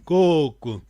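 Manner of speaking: Coco with a long first o; teacher models Coco with a long first vowel. Shown as a demonstration. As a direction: hold the first syllable of Coco longer. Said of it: "Aquele coco é vogal longa".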